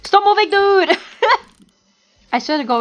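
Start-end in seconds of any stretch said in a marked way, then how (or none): 0.0-1.0 high, loud real yell; exasperated shout. Real, not acted. Gamer aiming at a moving player, exasperated shout. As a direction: shout it at a dodging target. Calls at moving target in game.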